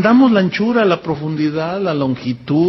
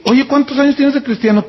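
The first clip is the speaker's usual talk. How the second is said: ordinary talk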